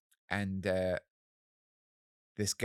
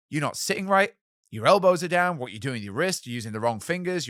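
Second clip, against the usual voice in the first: much higher, louder rapid-fire telling-off; scolding teacher; 6 semitones higher, 8 dB louder than usual. Retold or imitated. Retelling the audition teacher's scolding, high and loud. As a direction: bark the corrections like a stern examiner.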